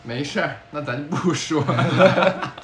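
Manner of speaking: ordinary talk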